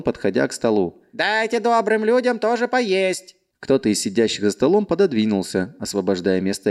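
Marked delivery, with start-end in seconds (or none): 1.1-3.4 high, slow call over noise; servant calling out. Acted. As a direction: call out like a busy tavern boy. Servant calls out to diners.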